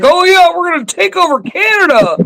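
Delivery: high, fast mock boast; mock a clueless gloat; dumb gloating voice. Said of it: "He's not like".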